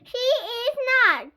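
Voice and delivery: high, loud, drawn-out protest; outraged cry. A narrator's reading. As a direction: cry out in indignant denial, stretching it. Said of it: "cried Chloe".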